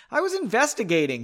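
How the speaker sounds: indignant, high-pitched retort; loud protest. A narrator's reading. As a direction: protest it with the voice pushed up. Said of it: "he said loudly".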